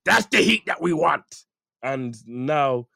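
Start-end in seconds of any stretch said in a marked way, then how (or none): 0.0-1.4 loud, high, growing enthusiasm; hammed-up promoter urging on his wrestler; approving boss impression. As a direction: punch out each phrase with delighted approval. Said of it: "he's done it parody and like".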